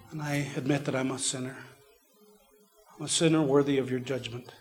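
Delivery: soft, hushed prayer with long pauses; quietly reciting a prayer of surrender; sinner's prayer. Retold or imitated. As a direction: lead a humble prayer, phrase by phrase.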